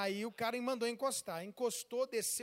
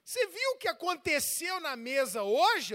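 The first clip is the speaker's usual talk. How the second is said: loud, raised-voice confrontation; acted anger; 4 semitones higher, 7 dB louder, slower than usual. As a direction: confront the wife loudly, pitched high. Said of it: "Eu tô com raiva".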